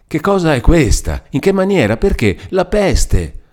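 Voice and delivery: high-pitched, startled bursts; shocked, disjointed exclamations; astonished questioning. A narrator's reading. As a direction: fire off bewildered questions. Lucia stunned at seeing Renzo.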